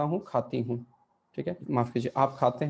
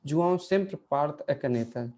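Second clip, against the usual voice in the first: slow example reading; much slower than usual. Shown as a demonstration. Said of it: "example sentence".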